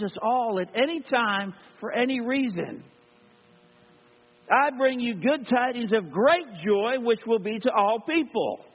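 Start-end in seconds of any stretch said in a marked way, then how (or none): 4.5-8.6 proclaiming scripture. Acted. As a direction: announce it like the herald angel. Quoting the angel's Christmas proclamation.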